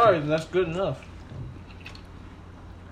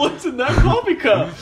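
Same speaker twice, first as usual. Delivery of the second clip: laughing; 7 semitones higher, 6 dB louder than usual. Pretend. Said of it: "let's say it one more".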